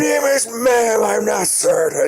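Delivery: very high, slow, drawn-out falsetto; imitating the customer. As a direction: camp offended correction, stretched. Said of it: "And he's like".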